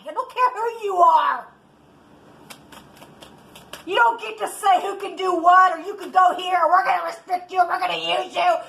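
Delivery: ordinary delivery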